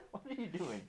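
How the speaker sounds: squeaky high talk to the dog. Real, not acted. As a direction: pitch way up, fast and amused. Talking to dog chewing bone.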